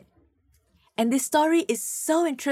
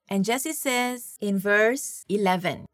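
normal talking voice

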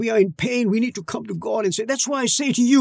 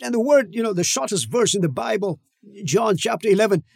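ordinary delivery

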